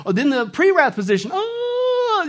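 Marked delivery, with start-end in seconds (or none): 1.3-2.3 high, held "oh"; comic high wail of dismay; mock wail. Mocking each rapture position.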